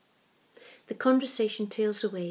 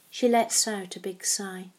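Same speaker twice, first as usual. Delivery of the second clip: ordinary delivery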